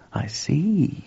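whispered, drawn out; slow. A narrator's reading. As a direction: let it sink in, whispering. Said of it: "said George slowly".

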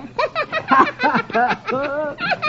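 squawky parrot laughter; high cackling laugh. A narrator's reading. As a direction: cackle like a parrot and its crooked owner. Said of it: "The proprietor and the parrot laugh".

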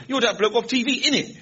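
high-pitched youth accosting a celebrity; mock youth-speak, high questioning "innit"; young bloke's slang. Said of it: "you know, they talk, young people".

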